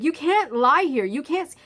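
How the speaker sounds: a firm nurse insisting; stern nurse. Retold or imitated. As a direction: nurse repeating that he can't lie there. Nurse repeating the order.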